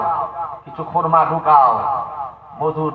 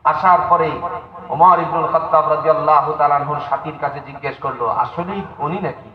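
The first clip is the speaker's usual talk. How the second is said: normal talking voice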